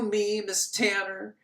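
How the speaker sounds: ordinary talk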